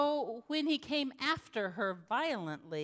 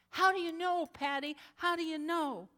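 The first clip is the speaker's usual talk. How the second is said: desperate question; 7 semitones higher than usual. Retold or imitated. Audrey asks her pastor how she knows, desperate. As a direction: beg for an answer.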